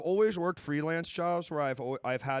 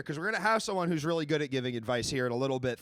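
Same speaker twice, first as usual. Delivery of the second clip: normal talking voice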